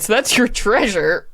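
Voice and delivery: usual speaking voice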